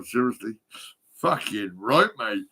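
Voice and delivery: ordinary talk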